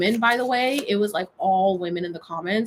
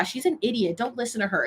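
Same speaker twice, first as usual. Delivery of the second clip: usual speaking voice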